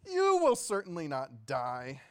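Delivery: loud, slow serpent's denial; accusing serpent. Acted. Serpent contradicting the woman, loud and drawn out. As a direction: draw out the contradiction, loudly. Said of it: "the accuser said to the woman".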